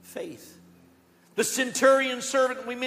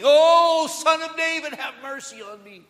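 loud pleading cry that fades away; a blind man begging Jesus at the top of his voice; 7 semitones higher, 9 dB louder than usual. Retold or imitated. Blind Bartimaeus crying to Jesus, loud desperate plea. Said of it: "yelling and screaming".

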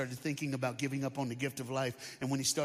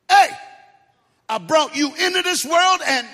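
dad's threat, yelled much higher and louder; angry dad yelling; 13 semitones higher, 14 dB louder than usual. Acted. The dad bursting into the bedroom, yelling high and loud. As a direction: bellow it like a furious father.

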